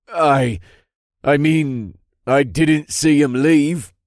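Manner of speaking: high, loud, slow frightened stammer; nervous. A narrator's reading. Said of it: "he tried again".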